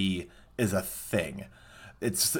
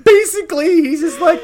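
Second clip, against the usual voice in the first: squeaky laughing; words squeezed out by laughter; laughing high; 8 dB louder than usual. Real, not acted. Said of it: "crying, laughing through words".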